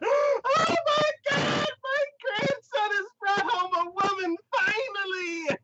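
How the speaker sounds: very high, loud mock squeal; hammed-up ecstatic wail, stretching the last word; overjoyed grandmother. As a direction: shriek it like a thrilled grandma. Acted grandmother overjoyed at grandson's girlfriend.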